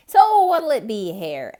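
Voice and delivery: loud high eager hare; Hare, pressing. A narrator's reading. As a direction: push for an answer. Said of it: "asked Hare".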